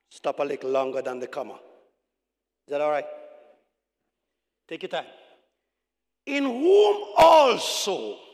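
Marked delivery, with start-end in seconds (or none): 6.3-8.2 very slow, loud, raised scripture reading. Real, not acted. Preacher reading Paul's verse aloud to church, slow reading. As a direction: stretch each word out and proclaim it. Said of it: "I'm gonna read it slowly".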